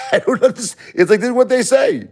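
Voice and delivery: high, laughing disbelief. Real, not acted. To viewers, laughing off the haters' claims, high-pitched. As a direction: laugh off the accusation.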